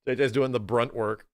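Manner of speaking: ordinary talk, nothing marked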